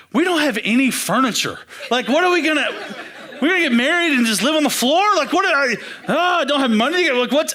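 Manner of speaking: spiral into mock panic, words tumbling out; hammed-up panicky rant; freaking out. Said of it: "I'm freaking out".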